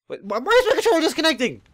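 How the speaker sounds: high-pitched, stammering question; alarmed disbelief. Real, not acted. Gamer whose controller disconnects, alarmed disbelief. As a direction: blurt it out, thrown by a sudden fault.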